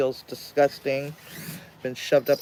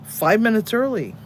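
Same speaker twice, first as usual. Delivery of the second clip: five minutes early, higher and louder; excited emphasis; 8 semitones higher, 6 dB louder than usual. Real, not acted. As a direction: celebrate the small win.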